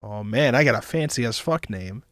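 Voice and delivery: ordinary talk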